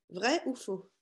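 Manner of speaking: normal talking voice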